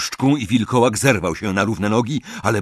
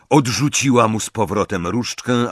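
usual speaking voice

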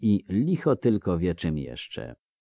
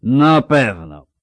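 loud, higher, slurring tipsy villager; drunk Melfi; 6 semitones higher, 13 dB louder, much slower than usual. Acted. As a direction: call out like a cheery drunk. Melfi drawls. (pijany Melfi, głośno i bełkotliwie)

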